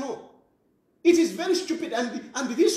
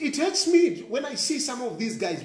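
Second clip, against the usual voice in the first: normal talking voice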